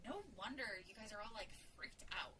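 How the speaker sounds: hushed, confidential tone; softly confiding to a friend. A narrator's reading. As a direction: keep it down so a child can't overhear. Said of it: "lowered her voice while saying".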